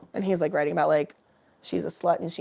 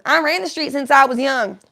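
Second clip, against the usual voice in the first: two voices, the last line loud; playing both parts; 4 semitones higher, 7 dB louder than usual. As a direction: switch voices for each line. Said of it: "I wanna do both parts".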